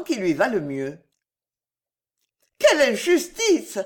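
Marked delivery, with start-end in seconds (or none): 2.6-3.8 high-pitched indignant cry; protesting outburst, pitch jumping up; exclaimed protest. A narrator's reading. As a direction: cry out at the unfairness. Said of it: "s'écria la jeune fille".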